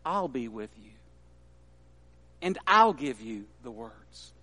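gentle reassurance. Acted. God softly reassures a doubting Moses, hushed. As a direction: speak it like a quiet comfort.

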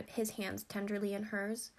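ordinary delivery

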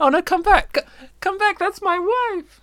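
very high, loud desperate cry; high panicked shout. Acted. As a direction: call after them in mock panic. Wife stolen, calling after.

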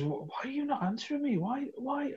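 high-pitched, softened exasperated plea; exasperated pleading. Retold or imitated. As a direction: mock-desperate questioning, voice pitched right up. Acting out own recruiter frustration.

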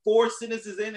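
ordinary talk

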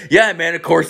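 high, quick-to-agree retold reply; eager acceptance. Kid accepting the player's apology, retold eager agreement. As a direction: agree readily, pitched well up.

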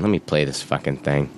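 ordinary talk, nothing marked

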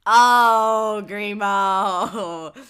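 loud laughing oh; laughing recognition. Real, not acted. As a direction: laugh out the name. Reacting to picture, laughing.

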